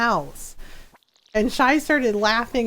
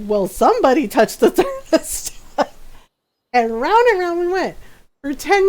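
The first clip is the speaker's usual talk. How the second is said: laughing; 6 semitones higher, 4 dB louder than usual. Retold or imitated. Husband quoted during laughing retelling.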